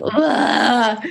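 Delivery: guttural burst of primal release; primal roar. Shown as a demonstration. Woman showing men's violent primal part, primal roar. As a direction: let out a short, drawn-out "ugh" from the gut.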